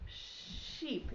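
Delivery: "sheep" breathed with a long sh; whispered sheep. Shown as a demonstration. Teacher stressing the sh sound for kids. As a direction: hiss the sh of "sheep". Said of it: "It starts with".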